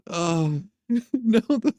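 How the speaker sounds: ordinary talk, nothing marked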